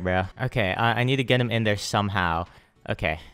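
ordinary talk, nothing marked

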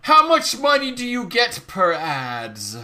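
loud, theatrical announcement with drawn-out words; dramatic message reading. Acted. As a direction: declaim the message grandly.

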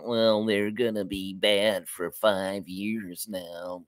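slow, low, gloomy cartoon drone; doleful slow-motion pessimist voice; Droopy. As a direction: drag it out glumly and softly. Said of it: "droopy dogs, saying".

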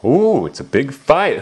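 plain reading voice

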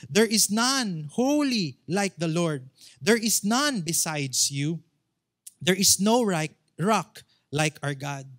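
preacher declaiming Exodus with rising force; forceful scripture reading. Real, not acted. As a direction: proclaim the verse to the congregation.

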